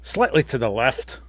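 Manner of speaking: put-on Scottish accent. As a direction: go into a quiet Scottish burr. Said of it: "It's a great Scottish accent".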